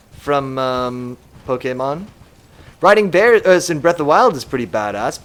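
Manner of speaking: ordinary talk, nothing marked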